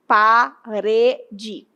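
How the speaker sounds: "parede" split into pa, re, de; syllable by syllable. Shown as a demonstration. Teacher asking which syllable is strongest, syllable-by-syllable "parede". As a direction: say "parede" in separate, drawn-out syllables. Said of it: "qual é a sílaba mais forte?".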